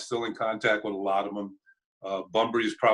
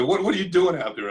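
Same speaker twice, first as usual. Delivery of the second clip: high, louder mock yell with a stumbled start; raised-voice retold scolding; 8 semitones higher, 5 dB louder than usual. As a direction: imitate an angry manager demanding an answer. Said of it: "He starts yelling at me".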